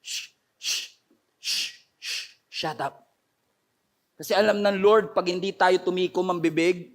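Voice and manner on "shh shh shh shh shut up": soft, unvoiced shushing into a low shut up; hushing. Preacher relays the Lord's order to keep quiet, hushing. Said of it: "kailangan tahimik ka lang".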